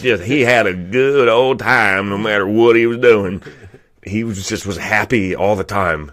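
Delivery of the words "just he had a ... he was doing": put-on Southern accent. Comic telling of an easygoing co-worker, in a Southern accent. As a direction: lean into a hearty Southern accent. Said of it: "super southern guy".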